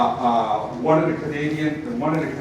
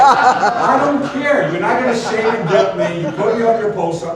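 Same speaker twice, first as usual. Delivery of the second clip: high, loud, laughing refusal; laughing defiance; 4 semitones higher, 4 dB louder than usual. Retold or imitated. Speaker defying the host's poster threat, laughing. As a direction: brush off the guilt trip.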